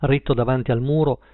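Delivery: plain reading voice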